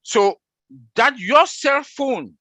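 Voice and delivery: high, emphatic and drawn out; hyper, fired up. Real, not acted. Explaining cell phone tracking to the group, fired up. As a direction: push it up high and slow, really fired up. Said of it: "I'm talking so that high".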